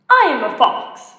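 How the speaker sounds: loud, bold fox announcing itself; lightly voiced fox. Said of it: "the fox said".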